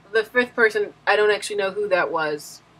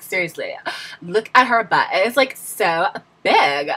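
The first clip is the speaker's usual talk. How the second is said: gossipy "like, oh my God" type; 4 semitones lower than usual. Acted. Gossiping to Becky about a girl, in a drawling put-on voice. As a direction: drag out "so" and "oh my gosh", fading lower. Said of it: "a type of person".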